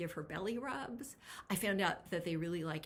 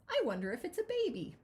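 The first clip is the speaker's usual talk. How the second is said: curious wonder; 10 semitones higher, 4 dB louder, faster than usual. Acted. Granny peeks at basket.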